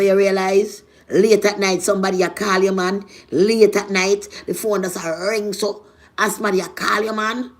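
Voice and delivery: ordinary talk, nothing marked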